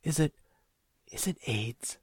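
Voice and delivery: halting, tentative motherly question; hesitant dread. A narrator's reading. Worried mother guessing son's trouble, hesitant dread. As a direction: break off, then dare to ask. Mother fearfully asks about illness.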